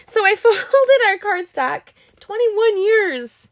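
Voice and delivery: high, slowed, laughing through tears; teary laugh. Real, not acted. Crafter recovering from tears while folding cardstock, teary laugh. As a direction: laugh to keep from crying.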